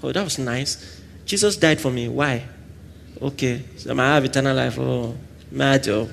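soft, flat-ish indifferent thanks; mock bored gratitude; unimpressed shrug. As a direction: brush off the gift. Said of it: "So you don't just say".

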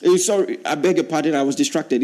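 ordinary delivery

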